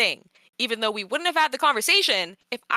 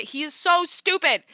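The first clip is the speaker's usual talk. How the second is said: pitched-up frustrated venting; fed-up, higher-pitched complaint; exasperated; 7 semitones higher than usual. Real, not acted. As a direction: burst out in sheer exasperation. Venting about the guy.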